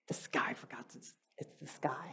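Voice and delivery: soft whisper, almost no voice; hushed child whisper. Retold or imitated. Mother voicing her son, whispering the answer. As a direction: breathe it like a secret.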